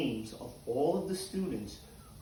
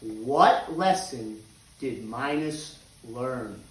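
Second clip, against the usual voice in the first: slow, deliberate question; 5 semitones lower, slower than usual. Shown as a demonstration. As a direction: question asked slowly with "lesson" stressed. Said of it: "Our question for this week is".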